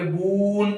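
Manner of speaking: बून with the ऊ stretched out long; बून, stretched vowel, teacher modelling; long drawn u. Shown as a demonstration. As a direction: draw out the ऊ in बून. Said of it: "इसको हम लोग खींच के पढ़ेंगे".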